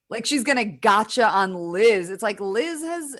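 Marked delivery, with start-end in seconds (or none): none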